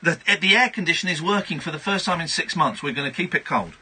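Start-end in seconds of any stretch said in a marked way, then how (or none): none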